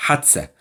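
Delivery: حادثة as a short, soft hadsa; clipped colloquial Egyptian حادثة, middle vowel dropped; Cairo dialect pronunciation. Shown as a demonstration. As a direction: say حادثة the Cairene way, two syllables with s for th. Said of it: "This is our Cairo dialect".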